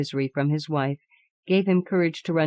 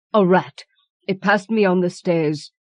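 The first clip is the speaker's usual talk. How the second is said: shaking voice; 3 semitones higher, 5 dB louder than usual. Acted. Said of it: "in shaking tones".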